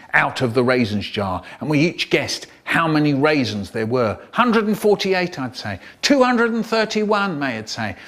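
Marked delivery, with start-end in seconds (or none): 4.3-5.4 higher, eager guess; a hint of an excited child. 6.0-7.4 higher, confident guess; a hint of May's voice.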